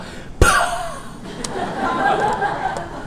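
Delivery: a high falsetto laugh that rises; grandpa's laugh. Acted. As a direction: let out an incredulous old man's laugh. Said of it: "이렇게 웃습니다".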